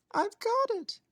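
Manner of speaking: very high squeaky mouse with a bright idea; excited mouse. Acted. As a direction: squeak it out like a sudden eureka. Mouse voice in story.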